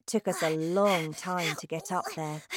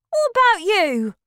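put-on high, loud boyish question; Bruno's voice; 13 semitones higher, 9 dB louder than usual. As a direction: toss the question back. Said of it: "he asked".

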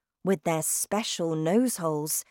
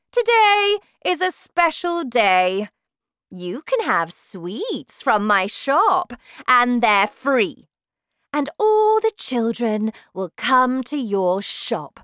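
sweet-talking witch; 7 semitones higher, 9 dB louder than usual. Acted. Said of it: "You will tell all the horrible children".

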